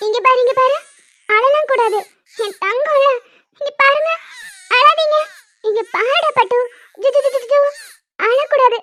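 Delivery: affectionate, slightly anxious hushing; soothing baby talk. Acted. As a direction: try to distract a crying baby.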